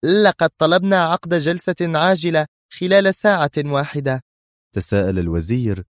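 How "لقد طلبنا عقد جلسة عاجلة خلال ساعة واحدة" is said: high-pitched, irritated reply; tense adviser's high, nervy retort; edgy, nervy. A narrator's reading. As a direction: answer on edge, voice pitched well up. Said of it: "قالت مستشارة الأمن القومي في عصبية".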